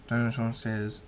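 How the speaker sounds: plain reading voice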